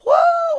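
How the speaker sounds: high shouted whoa; sudden high praise whoop; whoop of joy. Real, not acted. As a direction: let a joyful whoop fly. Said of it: "and shout just a little bit".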